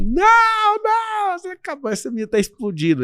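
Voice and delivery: a high falsetto pleading, desperate to get off stage; mock squeaky protest. Someone on stage whining in a high falsetto to the presenter who wants to go on, desperate. Joking reply about needing the bathroom.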